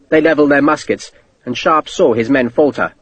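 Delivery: ordinary delivery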